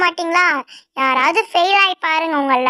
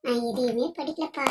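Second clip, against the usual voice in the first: soft, lower pleading whisper; hushed begging; 5 semitones lower, 11 dB quieter than usual. Acted. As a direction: beg quietly so the teacher won't notice. Begging a classmate during exam. (தோழியிடம் copy கேட்டு மெல்லிய கெஞ்சல்)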